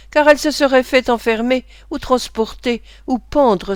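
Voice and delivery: ordinary delivery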